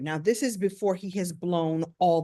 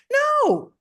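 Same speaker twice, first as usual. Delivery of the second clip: high, loud, drawn-out no; emphatic rejection; 7 dB louder than usual. Real, not acted. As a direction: cut it off with a loud protest. Rejects the posh stereotype.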